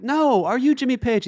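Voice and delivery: high, loud mock call; imitated insistent holler. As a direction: correct someone across the water. Edwin corrects across water.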